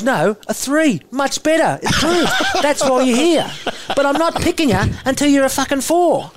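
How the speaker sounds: laughing impression of a harsh coach tearing into a player; imitating Eddie Jones berating. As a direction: bark the put-down like a brutal coach, half laughing. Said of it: "I'll go in and out of his voice".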